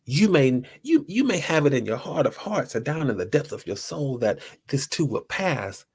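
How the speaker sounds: usual speaking voice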